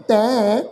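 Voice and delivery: ਤੈਂ with a nasal vowel; nasal pronunciation. Shown as a demonstration. Teacher models the bindi nasal sound. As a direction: give ਤੈਂ a nasal ending. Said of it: "ਬਿੰਦੀ ਲਾ ਕੇ ਨਾਸਕੀ pronounce ਕਰਾਂਗੇ".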